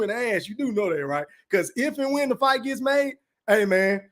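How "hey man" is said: usual speaking voice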